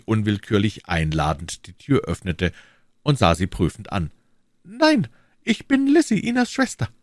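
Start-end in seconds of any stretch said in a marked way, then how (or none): 4.6-6.9 hohe, helle Mädchenstimme; junge Frau. A narrator's reading. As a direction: Lizzie als junge Frau sprechen. Narrator voices young Lizzie. (hohe Stimme einer jungen Frau)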